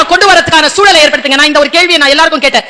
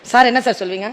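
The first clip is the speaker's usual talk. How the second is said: usual speaking voice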